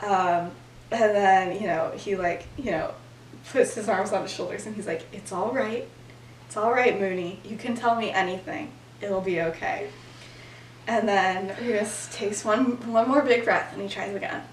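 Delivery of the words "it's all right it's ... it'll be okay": comforting. Retold or imitated. Comforting Remus in the story.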